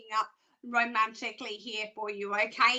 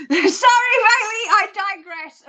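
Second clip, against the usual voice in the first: laughing loudly through the words; laughing apology; 7 semitones higher, 13 dB louder than usual. Real, not acted. Psychic laughing at her own alien joke while apologising.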